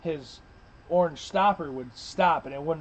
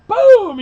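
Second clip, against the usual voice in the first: loud, high mouth-made boom; imitated gunshot boom; 15 dB louder than usual. Retelling a friend firing, a loud gunshot boom. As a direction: shout boom like a shotgun blast.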